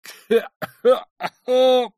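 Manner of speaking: loud, high acted coughing fit. A narrator's reading. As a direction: break into a helpless cough. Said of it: "она закатилась от кашля". (громкий приступ кашля)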